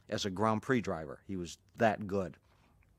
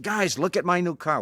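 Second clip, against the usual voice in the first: higher and louder, beaming with pride; proud excitement; 8 semitones higher, 7 dB louder than usual. Retold or imitated. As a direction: show off the new car, thrilled. Bruce proudly shows new car.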